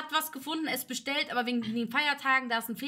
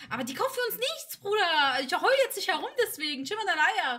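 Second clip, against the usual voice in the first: retold exasperated rant; 6 semitones higher than usual. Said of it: "Tutti weint, ich sag".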